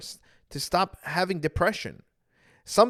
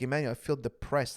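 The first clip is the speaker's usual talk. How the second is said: softer, lower, a touch heavy; soft sad confession; 4 semitones lower, 6 dB quieter than usual. Retold or imitated. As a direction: confide it quietly. Brother confiding, soft.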